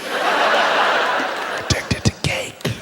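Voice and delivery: unvoiced mock whisper, slow; whispered aside. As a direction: whisper it like a confession.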